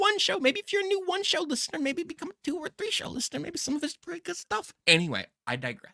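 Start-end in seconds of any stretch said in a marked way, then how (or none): 0.0-4.7 high, soft put-on character voice. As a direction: play a goofy character, pitched up. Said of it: "What accent was that?".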